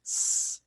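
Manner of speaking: a long voiceless s; just hiss the s; hissed s sound. Shown as a demonstration. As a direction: sibilant s alone. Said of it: "é uma sibilante".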